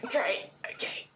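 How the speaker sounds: breathy hungover whisper, barely voiced. Dean whispers to Eileen after crashing, hungover whisper. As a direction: whisper it like your head is pounding.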